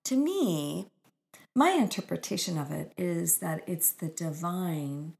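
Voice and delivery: usual speaking voice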